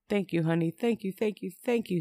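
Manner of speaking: high, overcome thanks; emotional gratitude. A narrator's reading. Man to child who forgave him, overcome gratitude. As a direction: thank them over and over, overcome.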